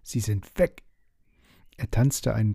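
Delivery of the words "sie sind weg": cheerful cry. Acted. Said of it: "rief er fröhlich".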